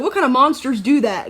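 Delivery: high, loud mock-horrified question; mock outrage. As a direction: play up fake disgust for a laugh. Mock outrage at friend's choice.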